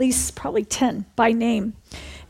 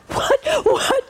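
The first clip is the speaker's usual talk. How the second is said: startled; 12 semitones higher than usual. Retold or imitated. Everyone startled by the revelation.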